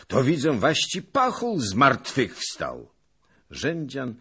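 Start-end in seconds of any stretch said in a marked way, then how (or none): 0.1-3.0 loud, high, drawn-out amazement; booming surprise, pitched up; astonished cry. A narrator's reading. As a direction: call it out slowly in wonder. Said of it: "zawołał pan Zagloba".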